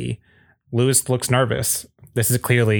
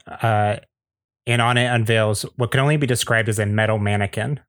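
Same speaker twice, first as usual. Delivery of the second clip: normal talking voice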